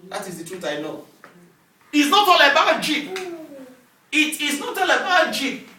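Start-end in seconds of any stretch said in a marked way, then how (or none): 1.9-5.7 loud, high-pitched sermon delivery; emphatic pulpit voice; forceful preaching. Real, not acted. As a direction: hammer the point home to the congregation.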